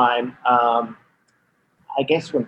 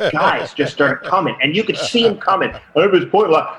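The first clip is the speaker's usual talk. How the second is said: ordinary talk, nothing marked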